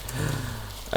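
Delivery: soft mock gasp of interest; imitated gasp. Girlfriend hearing the game's name, imitated soft gasp. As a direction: gasp softly as if impressed. Retold girlfriend's reaction to game.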